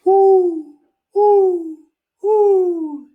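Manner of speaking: high, loud hooting owl. Pretend. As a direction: hoot like an owl up in a tree. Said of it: "says the owl".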